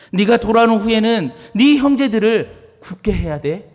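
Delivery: high, loud, pleading Jesus; Jesus voiced tenderly urging Simon; Jesus speaking. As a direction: urge Peter to stand firm. Paraphrasing Jesus speaking to Peter.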